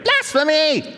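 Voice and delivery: high-pitched mock cry of accusation; outraged crowd. Crowd accusing Jesus of forgiving sins, mock outrage. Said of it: "like they do".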